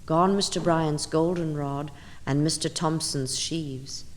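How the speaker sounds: ordinary delivery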